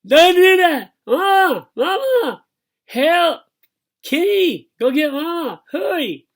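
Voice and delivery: very high, loud muffled screams; frantic screaming. A narrator's reading. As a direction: scream for Mama, lips stuck. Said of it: "Bi went nuts again".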